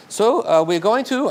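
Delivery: put-on high, loud German accent. Client calling about the jackets, in a German accent. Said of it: "I'll do my German accent".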